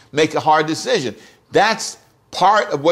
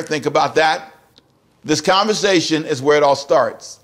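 normal talking voice

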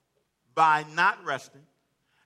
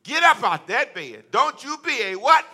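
loud, high motherly scolding; admonishing mother; 7 semitones higher, 9 dB louder than usual. Retold or imitated. Mother ordering her child out of bed, admonishing. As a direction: call out sharply like a mother rousing a child. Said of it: "She was admonishing me".

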